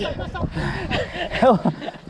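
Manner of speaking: high burst of laughter; high delighted laugh. Real, not acted. Laughing at jump a fence.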